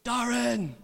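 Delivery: high, loud call of a name; shout across room. Retold or imitated. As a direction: imitate a loud boy yelling over the room. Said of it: "he shouts over this room".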